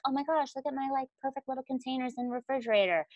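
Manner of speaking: lightly sent-up Susie Homemaker; mock perfect homemaker. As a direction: gush over tidy containers like a prim homemaker. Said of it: "I'm not Susie Homemaker".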